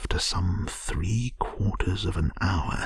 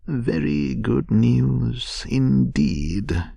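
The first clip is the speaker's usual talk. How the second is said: slow, higher-pitched doubt; dubious; 6 semitones higher, 5 dB louder, slower than usual. A narrator's reading. Rat hearing of Toad's repentance, dubiously. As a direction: draw it out, unconvinced. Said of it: "observed the Rat dubiously".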